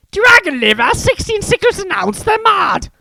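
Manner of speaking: very high, loud outraged shout; indignant shopkeeper woman. Acted. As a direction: rant about the prices. Shocked shopper shouting.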